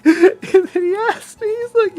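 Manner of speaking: words squeezed out through high laughter; can barely tell it for laughing; giggling through the setup; laughing hard. Real, not acted. Laughing while telling the story.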